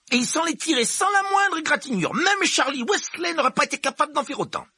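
high-pitched strict teacher, firm and proud; teacher presenting a gifted pupil, strict and pleased; stern professor McGonagall. Acted. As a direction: play the brisk headmistress type. McGonagall voice again.

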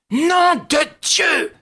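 high, drawn-out cry of shock; yelled curse. A narrator's reading. Ganimard curses as his prisoner escapes upward, high and shouting. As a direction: roar it as the lift shoots away. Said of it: "hurla Ganimard".

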